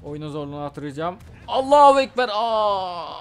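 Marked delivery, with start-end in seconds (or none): 1.5-3.1 loud, high hammed-up yell; loud joking battle cry; mock battle cry. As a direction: shout it as a joke war cry. Battle cry during game.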